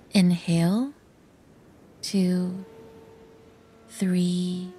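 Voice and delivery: soft, steady count with long pauses; guided breathing count. Shown as a demonstration. Narrator demonstrates the breathing process to listeners, soft spaced guided count. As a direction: count out each breath softly, evenly spaced. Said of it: "The breathing process goes like this".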